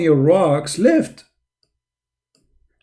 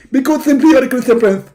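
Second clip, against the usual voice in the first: high, loud, indignant preacher voice; Zakir Naik impression; 9 semitones higher, 4 dB louder than usual. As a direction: bark it like a self-righteous debater. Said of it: "My name is Zakir Naik".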